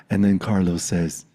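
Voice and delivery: usual speaking voice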